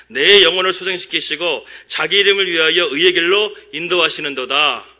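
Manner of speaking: 힘차게 낭독하는 성경 구절; scripture reading. Shown as a demonstration. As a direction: read the psalm out boldly. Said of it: "하나님의 인도함을 받는 아주 중요한 말씀이". (힘 있게 읽는 시편 낭독)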